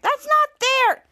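high, drawn-out mock whine; acted-out childish complaint; protesting. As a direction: protest slowly like a wronged kid.

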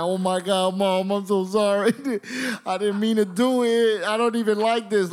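imitating a tearful wail; mock crying. Crying thirteen-year-old apologising to mom from the bathroom, mock wail. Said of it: "I start crying. I'm crying in the bathroom".

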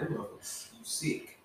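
whispered, appalled aside; a horrified whisper at the killer; hushed mock disgust. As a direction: breathe it out like you're disgusted. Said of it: "I'm like".

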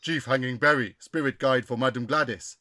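low-pitched, solemn spirit guide; caricatured spirit chief. Acted. Spirit guide introducing himself through the medium, caricatured chief. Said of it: "Bit racist".

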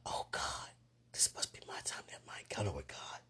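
low hushed whisper; scared driver thinking in a whisper; whispered thought. Retold or imitated. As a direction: whisper it in awe and dread. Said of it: "they thought about it".

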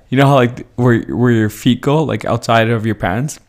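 ordinary delivery

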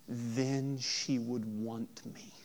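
a slow, fading whisper; faint whisper. Retold or imitated. Pastor voicing Merrick's longing for his mother, slow whisper. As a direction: let the words die away slowly in a whisper.

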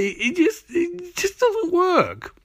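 very high, drawn-out exasperation; exasperated squeak. Real, not acted. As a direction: let the voice climb high in disbelief. Exasperated critique of the plot.

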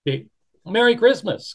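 high, loud called greeting; calling out. Acted. Beaver calls Merry Christmas after the bunny, loud. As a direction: call it across the water. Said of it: "called the Beaver".

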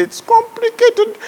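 drawn-out high "It's complicated", slow; high mock it's complicated. Preacher teasing the congregation, high and slow.

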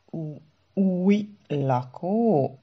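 stammered, very slow stunned question; astonished. A narrator's reading. Szara hearing of a new occupant, astonished. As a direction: draw it out in disbelief. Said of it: "Szara hangjából elképedés csendült ki".

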